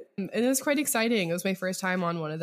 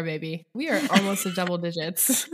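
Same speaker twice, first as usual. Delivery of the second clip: normal talking voice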